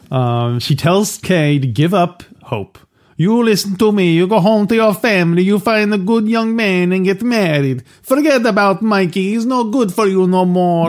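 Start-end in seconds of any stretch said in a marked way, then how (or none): 3.2-10.9 put-on high-pitched Italian accent as Mama Corleone. Mama Corleone telling Kay to forget Mikey, in an Italian accent. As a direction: play the old Italian mother warning Kay off in a heavy accent.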